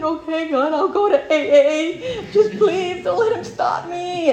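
high, loud, slow crying plea; retold tearful prayer to God while driving. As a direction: beg God through tears. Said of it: "I started crying".

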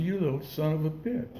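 quietly retold private curse; retold cursing. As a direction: curse him under your breath, as retold. Muttering to himself, cuffed in car.

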